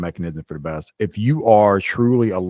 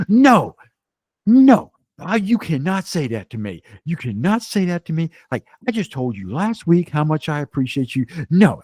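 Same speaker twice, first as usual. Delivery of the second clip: much higher, louder outraged friend; mock protesting buddy; 9 semitones higher, 4 dB louder than usual. Sober buddy objecting to a friend's complaint, high, outraged. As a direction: play the riled-up friend who won't hear it.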